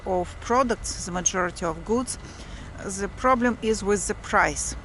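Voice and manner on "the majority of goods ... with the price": ordinary talk, nothing marked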